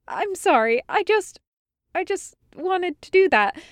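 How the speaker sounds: high, flustered halting apology; apologetic. A narrator's reading. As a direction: stumble over it, pleading to be understood. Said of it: "she said apologetically".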